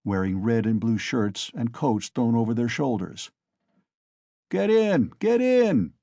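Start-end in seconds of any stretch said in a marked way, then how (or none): 4.5-5.9 loud high bellow; rowdy call to climb aboard; drunken shout. A narrator's reading. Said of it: "shouted one of them".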